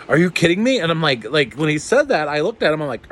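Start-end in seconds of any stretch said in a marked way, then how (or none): 0.1-0.8 higher, louder, incredulous excitement; louder disbelief. Retold or imitated. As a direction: blurt it out, overwhelmed with joy. Repeats his disbelief, more excited.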